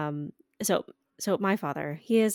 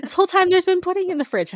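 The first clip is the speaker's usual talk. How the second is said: much higher and louder, delighted disbelief; amazed exclaim; 11 semitones higher, 10 dB louder, faster than usual. Real, not acted. Telling her co-host about the hidden pudding, in loud amazement.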